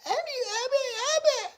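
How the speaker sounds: very high squeaky babble; imitated baby babbling.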